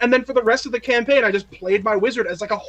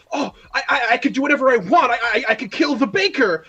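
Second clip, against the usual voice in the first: high, fast, giddy power-trip voice; the reckless newbie who thinks nothing can stop her; giddy new player; 4 semitones higher than usual. Retold or imitated. Excited newbie wizard bragging to the table, high and giddy. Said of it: "you're like".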